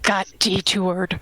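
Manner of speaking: slow, lightly put-on gruff voice; best impression of Mezzo. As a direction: drag it out in a rough imitation. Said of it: "And I do my best impression".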